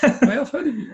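high, bright put-on voice of an eager stranger; mock stranger greeting. Co-host imitates a stranger, high mock greeting. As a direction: squeak it like someone who thinks they know you.

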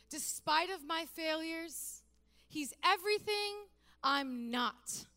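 high, slow, impassioned; fervent preaching. Acted. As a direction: build it with passion. Climactic preaching over music.

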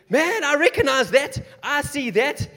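high, loud, eager cry of recognition; excited recognition. Acted. Saints spotting the gates, calling out excitedly. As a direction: call out, thrilled to recognize him.

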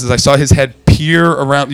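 ordinary talk

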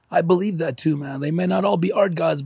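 normal talking voice

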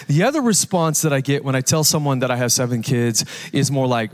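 ordinary delivery

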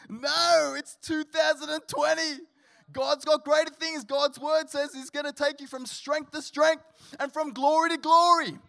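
loud, high fired-up preaching; rally the congregation, voice soaring; full-force preaching. Acted. Pastor rousing the church to hope in full voice.

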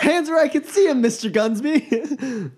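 stern command dissolving into giggles; laughing order. Acted. Order repeated through laughter.

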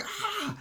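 high-pitched little mock scream, kept soft; tiny high imitation shriek. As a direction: imitate her scream without the volume. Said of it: "she screams".

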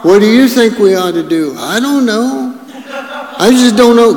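high-pitched mock whining; helpless complainers. Grumblers in their tents asking what to do, mock helpless.

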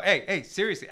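ordinary talk

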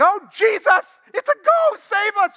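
very high, loud, hammed-up shriek of panic; mock terrified scream. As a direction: play frightened disciples screaming at a ghost. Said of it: "They're just freaking out".

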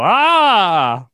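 loud, high comic "Ah!"; mock scared scream. Customer startled by a man in a scary mask, mock scream. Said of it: "So I go".

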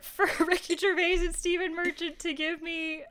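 high, loud, laughing almost to tears; laughing hard. Real, not acted. Laughing about the wait.

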